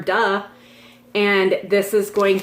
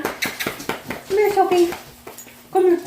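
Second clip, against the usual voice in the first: calling the dog; 10 semitones higher, slower than usual. Real, not acted.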